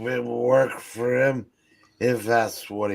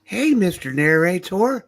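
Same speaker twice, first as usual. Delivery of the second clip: silly high voice; 10 semitones higher, 6 dB louder, faster than usual. Pretend. Calling the show's narrator.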